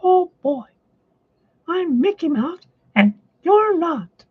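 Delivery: very high, squeaky cartoon mouse falsetto; a bright, boastful little cartoon mouse; Mickey Mouse voice. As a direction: pitch way up into a cheerful cartoon squeak. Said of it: "Sometimes I act out the characters like".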